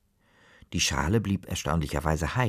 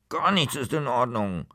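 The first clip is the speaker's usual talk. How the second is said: sulky mumble; 4 semitones higher than usual. Acted. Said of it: "murmelte Kaspar beleidigt".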